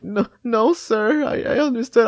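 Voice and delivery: put-on high, pleading kid voice; mock pleading. Kid pleading with police officer, high mock pleading. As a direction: beg like a scared child.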